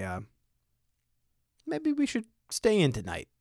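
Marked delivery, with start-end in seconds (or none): 1.6-3.3 worried aside. Pretend. Imagined worried parent line.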